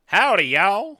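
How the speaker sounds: loud, high Southern holler; mock Southern greeting. Co-host teases about howdy and y'all, loud Southern. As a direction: belt it out with a big Southern twang.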